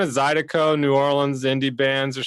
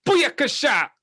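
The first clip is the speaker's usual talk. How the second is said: high hype Jamaican shout-out; big Jamaican toast; Jamaican accent; 12 semitones higher than usual. Pretend. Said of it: "good Jamaican accent".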